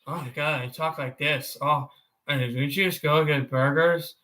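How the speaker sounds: low cartoon character impression; Bob's Burgers. Streamer imitating a burger shop worker, low character voice. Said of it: "That's my Bob's Burgers".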